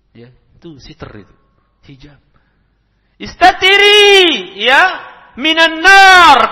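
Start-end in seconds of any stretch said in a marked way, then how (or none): 3.2-6.5 sangat keras dan tinggi, ditarik sangat pelan; seruan lantang. Acted. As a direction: panjangkan tiap suku kata sambil berseru. Mengulang sabda Nabi. (mengulang sabda Nabi dengan seruan panjang)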